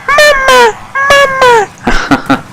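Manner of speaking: put-on very high baby voice calling out; high, whiny baby cry; crying toddler. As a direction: imitate a little child wailing for mom.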